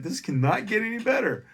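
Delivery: high, loud elated squeal; overjoyed. Retold or imitated. Comic thinking of his luck, high elated squeal. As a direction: burst with joy at your luck.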